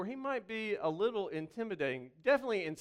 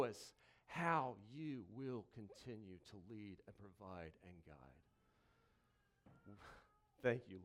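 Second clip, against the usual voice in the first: soft prayer; 6 semitones lower, 6 dB quieter than usual. Acted. Pastor prays softly to the Lord before the sermon. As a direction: pray it gently over the room.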